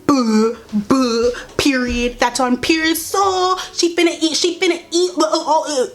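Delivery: high, exaggerated stan-account imitation; mock them with overdone, crazy-sounding slang; mocking online slang. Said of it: "You sound crazy".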